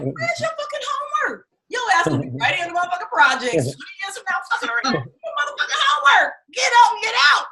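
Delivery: high, loud scolding shout; yelling father. Retold or imitated. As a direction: chase the kid to homework. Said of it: "my father was like".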